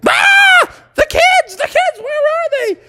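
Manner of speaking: very high falsetto panic; panicked mother. Acted. Mother finds the kids' room empty, high panicked shriek. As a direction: shriek it as a frantic cartoon mom. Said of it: "and Mother Frog was like".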